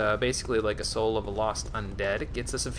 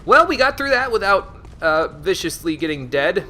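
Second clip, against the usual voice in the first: much higher, louder relieved cheer, then slowing; triumphant relief; 7 semitones higher, 7 dB louder than usual. Real, not acted. Player cheering after surviving a hard stretch. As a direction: crow with relief at making it through.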